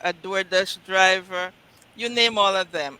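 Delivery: ordinary delivery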